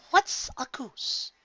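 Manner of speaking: slow, high, breathy puzzled question. A narrator's reading. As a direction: ask in honest confusion. Confused question.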